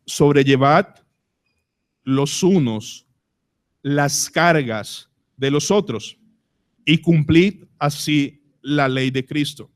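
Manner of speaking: verse read slowly with long pauses; slow solemn reading. Shown as a demonstration. Pastor reading the verse to the church, slow and solemn. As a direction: let each phrase of the verse sink in.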